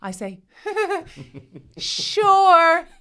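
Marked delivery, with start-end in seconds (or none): 0.6-2.9 high, loud, exaggerated welcome; overdone willingness. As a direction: put on a big eager yes for the children. Acted falsely cheerful reply to kids.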